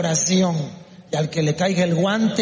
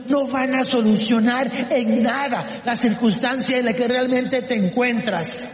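ordinary talk, nothing marked

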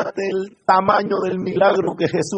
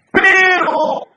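very high, loud, stretched single word; dramatic turn; 13 semitones higher, 5 dB louder than usual. A narrator's reading. Preacher turning the story toward danger, dramatic loud exclamation. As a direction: sound the alarm on one held word.

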